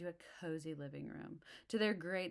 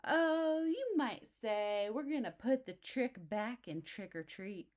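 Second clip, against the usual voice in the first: high, loud slow sly boast; scheming bully; 6 semitones higher, 6 dB louder, slower than usual. Acted. Too Tall lures the cubs, high, slow and sly. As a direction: relish the mischief.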